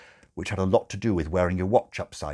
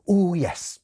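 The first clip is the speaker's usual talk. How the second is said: a hint of the nurse, higher; lightly voiced nurse; 9 semitones higher, 6 dB louder than usual. Nurse hiding disappointment from the father, lightly voiced. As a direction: politely cover disappointment. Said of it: "she said".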